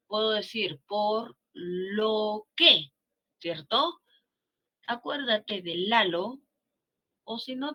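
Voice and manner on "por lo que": "Por la que" very slow, each word spaced; drawn out. Shown as a demonstration. Teacher spelling out por lo que.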